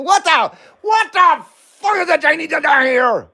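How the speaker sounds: high, hammed-up movie line; movie quote. Replaying the movie quote for chat, high and hammy. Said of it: "You wanna hear that again?".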